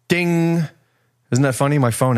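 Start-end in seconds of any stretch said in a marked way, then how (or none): 0.1-0.7 high ringing "Ding"; ding sound. Retold or imitated. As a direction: chime it like a bell. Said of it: "'cause she wrote ding".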